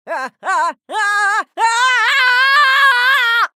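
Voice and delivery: loud, high cackle building to a long shriek; mad scientist laugh. Acted.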